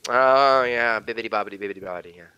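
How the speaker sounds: lazy, trailing fake Italian accent; mock Italian accent. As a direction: sink into low Italian-accent nonsense. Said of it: "I can do an Italian accent".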